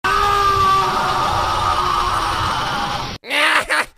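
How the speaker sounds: long, loud, high guffaw; a huge mocking belly laugh; booming laugh. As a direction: laugh your head off at bad news. Laughing at the Saiyans' deaths.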